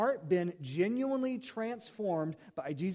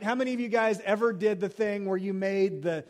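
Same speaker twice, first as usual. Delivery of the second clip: ordinary talk, nothing marked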